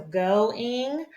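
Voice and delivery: GOING said as GO, then ING; split into parts. Shown as a demonstration. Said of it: "Ing is its own little".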